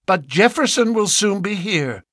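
put-on high, spirited young woman. Lucy answering her father with spirit. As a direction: answer back with pluck. Lucy's voice held.

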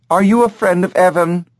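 high, chirpy parrot voice; a curious bird peering down; talking bird. Acted. Bird asks the boy about Evan, high parrot voice.

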